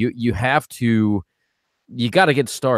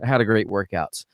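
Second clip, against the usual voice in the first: ordinary talk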